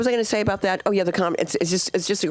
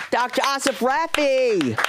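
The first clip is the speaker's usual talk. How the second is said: slow, high, drawn-out name announcement; stage introduction; 7 semitones higher, slower than usual. Real, not acted. Host brings up the doctor who taught her, announcing grandly. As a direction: announce the guest, stretching out the name.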